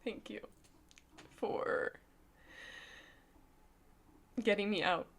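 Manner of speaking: soft, tearful thank-you, voice nearly gone; fighting back tears while thanking her; real crying, barely holding it together; choked-up thanks. Real, not acted. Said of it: "I don't know why I'm so emotional".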